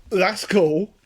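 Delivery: mock inventor delight. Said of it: "So he thought".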